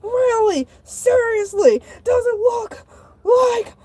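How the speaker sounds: high-pitched, incredulous escalation; exasperated outburst. Acted. Shark answering the manager's silly question, exasperated. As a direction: drag out each word, rising into hysteria.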